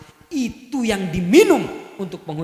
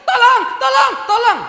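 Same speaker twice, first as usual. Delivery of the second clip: much higher, louder "tolong, tolong, tolong"; shrill repeated cry; 13 semitones higher, 8 dB louder than usual. Shown as a demonstration. Preacher acting a frantic plea for help, high and loud. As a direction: cry out again and again, pitched up. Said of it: "Masih biasa".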